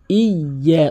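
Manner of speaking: "ইয়া" slowly, y held double; doubled-letter pronunciation. Shown as a demonstration. Teacher demonstrating tashdid, slow and emphatic. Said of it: "তাসদিদের উচ্চারণ double উচ্চারণ হয়".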